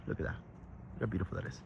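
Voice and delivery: breathy hushed admiration; whispered awe. Real, not acted. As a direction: hush it, in quiet wonder.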